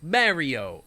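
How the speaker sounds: imitated New York Mario; Mario as a New Yorker says it; New York accent. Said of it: "they say it like a New Yorker".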